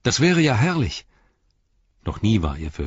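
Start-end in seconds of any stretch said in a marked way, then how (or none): 0.0-1.0 high and louder, overjoyed; joyful delight. A narrator's reading. Merry accepting the king's invitation to ride, delighted. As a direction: burst out in glad disbelief. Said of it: "freudig überrascht".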